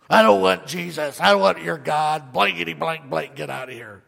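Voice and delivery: hostile rejection. Acted. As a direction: snarl the refusal, then let it die. Hostile person rejecting invitation.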